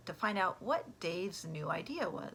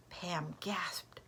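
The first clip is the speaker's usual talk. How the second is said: usual speaking voice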